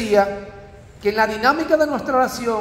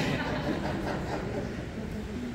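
light real laugh after a joke; soft chuckle at own joke; 13 dB quieter than usual. Real, not acted. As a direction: let a short laugh slip out.

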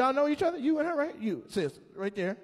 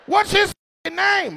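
high, loud, slow question; high slow shouted mock buyer; 11 dB louder, slower than usual. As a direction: call out like a buyer at auction. Mock auction dialogue.

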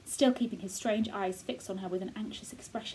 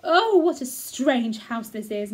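put-on slow, louder girl drawing out the oh; a girl wondering at the gloomy manor; girl's voice; 3 semitones higher, 10 dB louder, slower than usual. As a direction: marvel at it slowly, wide-eyed. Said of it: "Mary said".

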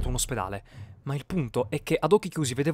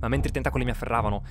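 plain reading voice

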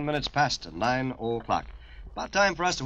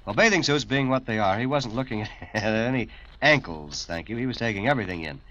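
ordinary talk, nothing marked

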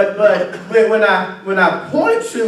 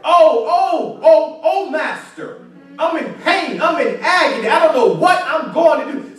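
pleading, pitched-up lament; crying out in pain; 4 semitones higher than usual. Acted. Worshipper crying out to the master in pain, an acted higher plea. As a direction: plead from a place of agony. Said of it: "you gotta cry out to him".

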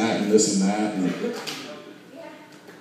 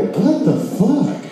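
baffled; 11 semitones higher, much slower than usual. Retold or imitated. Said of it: "They go".

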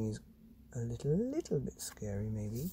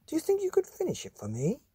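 much higher, sing-song doctor voice; coaxing doctor; 4 dB louder than usual. Acted. Doctor asking the boy to finish drawing, high coaxing. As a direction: coax the boy gently like a kindly doctor. Doctor Plunger coaxes John.

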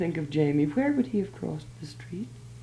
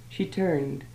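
usual speaking voice

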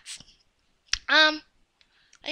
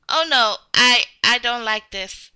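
ordinary talk

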